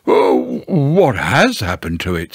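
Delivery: mournful, swooping question; doleful donkey. A narrator's reading. Eeyore asking Pooh about his tail, dolefully. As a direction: wail it gently, dolefully.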